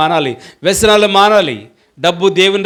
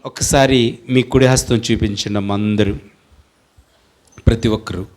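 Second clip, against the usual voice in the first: lower, hushed prayer voice with long pauses; leading prayer; 5 semitones lower than usual. Acted. Leads congregation in hands-on prayer. (తగ్గిన స్వరంతో నెమ్మదిగా ప్రార్థన)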